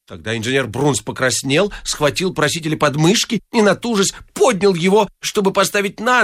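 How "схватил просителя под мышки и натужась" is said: ordinary delivery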